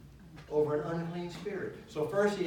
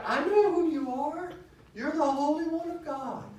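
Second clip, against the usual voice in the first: much higher, loud and strained; demon's shout; 14 semitones higher, 7 dB louder than usual. Retold or imitated. Preacher voicing the possessed man crying out, high and loud.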